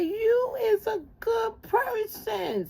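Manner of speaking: very high, slow mock-tearful squeal; comic squeaky impression, every word stretched; mimicking someone. Staffer praising the tearful caller, mimicked squeal. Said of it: "She went like this".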